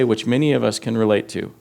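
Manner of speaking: ordinary talk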